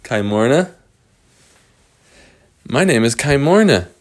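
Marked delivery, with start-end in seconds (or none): 2.7-3.9 lightly voiced girl introducing herself; little girl character. Little girl in the cage telling visitors her name, lightly acted. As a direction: announce the name brightly in character, falling at the end.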